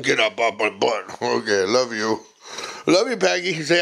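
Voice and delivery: ordinary talk, nothing marked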